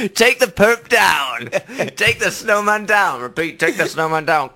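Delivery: high, loud, slow police command; mock police yelling; police shout. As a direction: shout orders like an officer at a takedown. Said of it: "then the police go".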